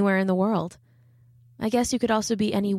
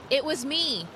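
calling out; 7 semitones higher than usual. A narrator's reading. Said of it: "I called".

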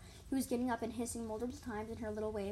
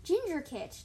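high, loud name call; raised shout; 7 semitones higher, 6 dB louder, slower than usual. A narrator's reading. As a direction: call the kit's name urgently. Calling out to the running kit.

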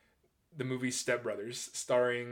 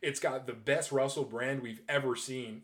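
usual speaking voice

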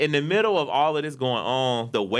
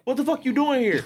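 raised, heated mock demand; angry; 12 semitones higher than usual. Boyfriend turning from the bystander to his girl, angry. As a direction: act out turning on the girlfriend. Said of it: "angry at me".

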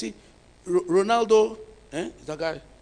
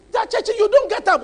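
ordinary delivery

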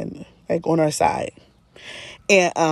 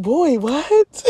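mock disbelief; 8 semitones higher than usual.

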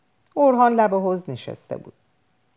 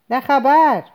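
loud, much higher outburst; the father's startled exclamation; alarmed cry; 9 semitones higher, 7 dB louder, slower than usual. Acted. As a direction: cry out in shock at the darkened sky. Father cries out at the sky.

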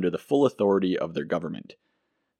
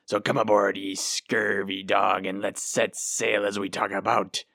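hammed-up movie pirate brogue, slowed; pirate accent. Podcast host welcoming listeners aboard in a hammed-up pirate accent. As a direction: growl it out like a storybook buccaneer. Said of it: "my not so great impression of ... pirate speak".

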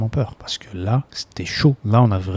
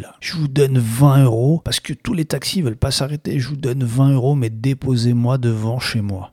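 put-on higher, slurring drunk man begging for a ride; drunk passenger voice; 3 semitones higher than usual. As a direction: play the lost, wasted man pleading. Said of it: "je dis".

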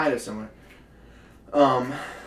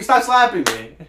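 raised, higher protest; loud protest; 6 semitones higher, 7 dB louder than usual. Acted. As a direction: tell them to stop, loudly. Partner slapping in scene.

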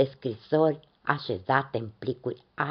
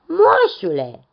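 calling out; 4 semitones higher, 9 dB louder, slower than usual. A narrator's reading. Tree calls the old man.

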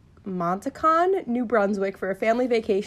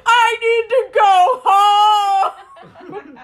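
loud, high, slow mock wail through laughter; mock crying; 11 semitones higher, 9 dB louder, much slower than usual. Retelling crying over the cookie, a loud high mock wail. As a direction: wail it like a kid about to sob. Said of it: "I started to cry".

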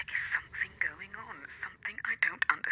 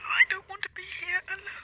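frightened plea; 10 semitones higher than usual. Acted. Frightened wife pleading on phone.

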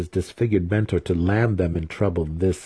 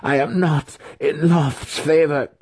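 high, agitated ghostly voice; shrieking spirit; 8 semitones higher than usual. A narrator's reading. Spirit out of favour warns the wizard, shrieking. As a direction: plead in a raised spirit voice. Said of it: "the spirit shrieked".

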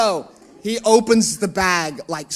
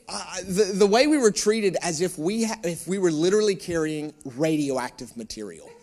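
normal talking voice

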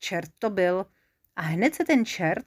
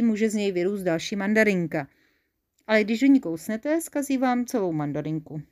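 ordinary delivery

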